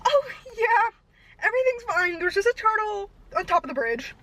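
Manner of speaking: talking to the cop, voice high with fear; panicked. Retold or imitated. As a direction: voice cracking in nervous panic. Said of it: "and I'm like".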